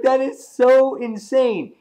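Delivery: high, loud, slow exclamation; floored fan reaction; amazed disbelief. Retold or imitated. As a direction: stretch each word in amazement. Retelling own shocked reaction.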